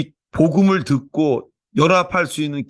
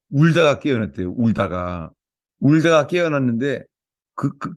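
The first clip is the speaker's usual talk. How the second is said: normal talking voice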